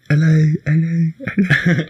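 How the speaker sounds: mock echo voice; his own echo. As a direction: do your signature echo. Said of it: "That's yours".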